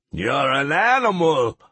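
high, straining retort; strained effort. A narrator's reading. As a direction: manage the words through pain. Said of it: "the Tegus managed".

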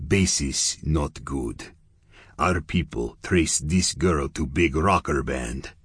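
menacing Russian gangster, clipped English; lightly voiced Russian heavy pressing for answers; a hint of a Russian accent, suspicious. Russian gangster Yevgeny speaks.

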